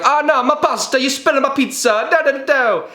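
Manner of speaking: high mock Italian whine; high-pitched fake Italian accent. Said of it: "It's like".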